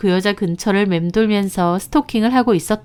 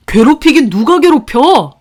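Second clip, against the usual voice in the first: loud, high indignant shout; angry denial; 6 semitones higher, 8 dB louder than usual. Acted. Husband shouting back denial at his wife, loud and high.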